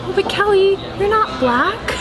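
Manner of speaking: high, gently amused mother; imitating mom breaking the news; gentle mom. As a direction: mom kindly pointing out the obvious. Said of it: "she goes".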